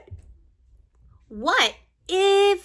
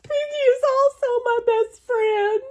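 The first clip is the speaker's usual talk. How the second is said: mock wailing; 12 semitones higher than usual. Gerald tells Doctor Cat pigs make him sneeze, hammed-up high wail. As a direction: wail it like a tragic child.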